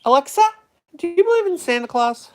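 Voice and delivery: high, loud question; calling out. Real, not acted. User quizzing the voice assistant for fun, calling out. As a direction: pitch it up and project to a listening device.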